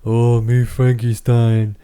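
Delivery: slow, loud lumbering monster voice; dumb Frankenstein voice. Retold or imitated. Mocking the Frankenstein voice she did before, slow lumbering brute. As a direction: drag it out like a clumsy brute. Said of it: "I made fun of in the Frankenstein thing".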